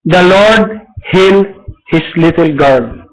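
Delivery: normal talking voice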